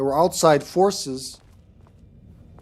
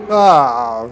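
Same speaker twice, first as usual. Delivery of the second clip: startled yelp; 10 dB louder than usual. Real, not acted. Jumpscare by monster.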